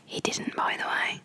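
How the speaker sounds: hushed, breathy aside; whispered aside. Real, not acted. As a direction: whisper it behind his back. Sneaky aside about co-host.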